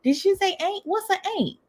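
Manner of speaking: very high, incredulous questioning; puzzled listener. Acted. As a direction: imitate someone baffled by Southern words. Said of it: "You're like".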